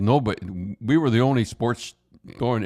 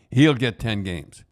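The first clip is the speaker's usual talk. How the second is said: ordinary talk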